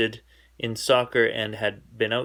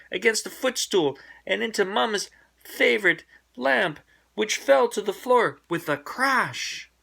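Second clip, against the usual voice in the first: climbing higher and higher toward the crash; mounting excitement; 9 semitones higher, 3 dB louder than usual. A narrator's reading. Narrator following the bouncing ball, climbing excitement. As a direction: build the tension to the crash.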